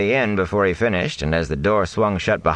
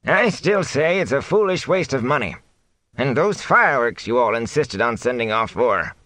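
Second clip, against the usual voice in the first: grumpy thatcher; 8 semitones higher than usual. A narrator's reading. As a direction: drag it out grudgingly. Sen voiced.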